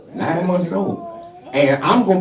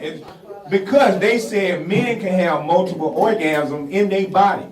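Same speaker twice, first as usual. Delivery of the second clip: ordinary talk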